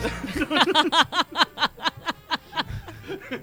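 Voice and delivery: long, high, soft staccato laugh; helpless giggling. Real, not acted. Laughing at the slip.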